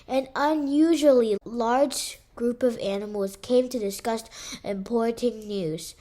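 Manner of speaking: usual speaking voice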